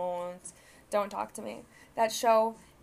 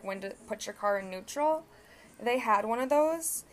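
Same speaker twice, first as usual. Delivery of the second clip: ordinary talk, nothing marked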